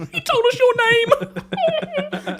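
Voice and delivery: put-on high, slow, thrilled child voice; giddy kid squeal. Excited kid quoted, high squeal.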